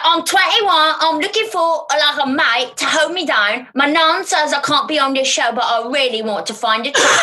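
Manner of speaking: high put-on British reality-show accent; British Love Island contestant. Mimicking Love Island contestants, trashy British accent. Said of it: "like, Love Island".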